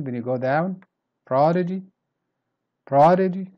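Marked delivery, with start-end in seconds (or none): 1.3-3.5 "Prodigy" high and loud on "prod"; strong start. Shown as a demonstration. Teacher repeating for learners, "Prodigy" stressed up front. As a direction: punch the first syllable of "Prodigy", then ease. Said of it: "Start strong".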